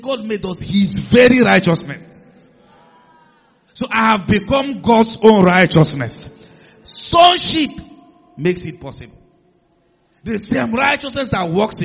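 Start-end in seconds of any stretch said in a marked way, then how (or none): 0.0-9.2 loud, building preaching cry, peaking on Sonship; rising proclamation. Real, not acted. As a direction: climb to a ringing peak.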